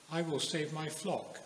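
ordinary delivery

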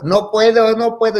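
high, whiny retold protest; hammed-up tearful panic; crying and yelling. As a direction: act out a wailing child refusing. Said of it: "y yo lloraba y gritaba".

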